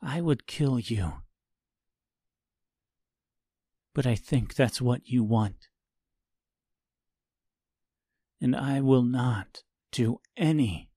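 lightly voiced hard young man; quiet cold refusal. Tip refuses to kill.

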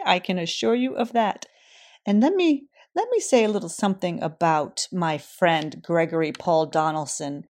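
loud, upbeat announcer read; bright podcast outro. Acted. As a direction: sign off the show like a host.

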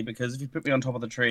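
ordinary talk

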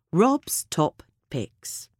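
ordinary delivery

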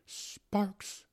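high-pitched blissful savoring; high, rapturous little exclamation; blissful repeat. Acted. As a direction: relish the word with eyes screwed up. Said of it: "screwing up his eyes".

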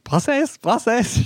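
high-pitched call across a crowd. Retold or imitated. Calling to queen from crowd.

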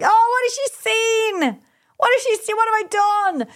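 high-pitched mock panic; retold panic. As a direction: wail it as an imitated panicked parent. Retelling her panicked thoughts.

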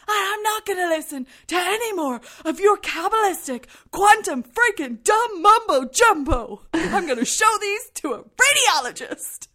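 high, loud furious yelling; furious shout. Retold or imitated. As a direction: scream it up the stairs at him. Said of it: "he's still shouting".

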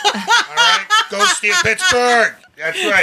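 laughing. Real, not acted. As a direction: giggle out the team cheer. Laughing through sports joke.

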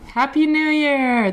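big, loud, high happy new year; loud greeting. Real, not acted. Sister greeting her sister's audience, loud and high. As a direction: shout it out to the listeners. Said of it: "That was very loud".

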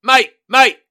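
high, loud call across the road; loud hailing call. Retold or imitated. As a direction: shout to get his attention. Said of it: "eventually he goes".

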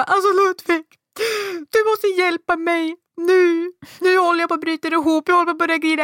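high, frantic plea near tears; desperate pleading. Retold or imitated. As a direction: beg the agent, on the verge of breaking down. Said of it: "Jag bara". (förtvivlad bön nära gråten)

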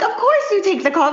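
laughing, high and bright. Retold or imitated. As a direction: answer it with a laugh. Self-quote answering a question, laughing.

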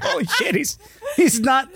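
high, laughing disbelief; excited disbelief. Real, not acted. Reading AI sex chat to friend, high laughing disbelief. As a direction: squeal it out in shock.

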